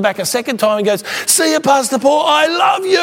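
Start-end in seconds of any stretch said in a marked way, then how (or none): 1.3-3.0 very high shouted farewell; mimicked exuberant shout, soaring in pitch; imitating loud talker. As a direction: holler an affectionate goodbye across the café. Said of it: "talk at one volume loud".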